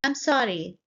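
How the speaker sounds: ordinary talk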